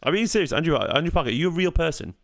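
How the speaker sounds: normal talking voice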